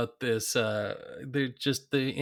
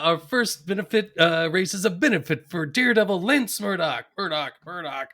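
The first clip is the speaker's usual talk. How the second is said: loud, raised announcer with echoed words; racetrack announcer; 5 semitones higher, 9 dB louder than usual. Retold or imitated. Co-host quoting the benefit race intro, announcer voice. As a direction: project it like a track PA, echoing key words. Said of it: "I love this when he goes".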